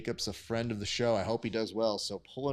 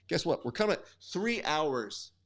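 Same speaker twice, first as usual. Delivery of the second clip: ordinary talk, nothing marked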